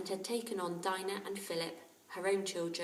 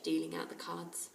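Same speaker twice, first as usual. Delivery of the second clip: ordinary delivery, nothing marked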